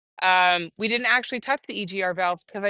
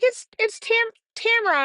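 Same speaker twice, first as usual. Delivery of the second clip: much higher-pitched caller voice; imitated caller. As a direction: put on a high phone-greeting voice for the caller. Voicing caller in retold phone call.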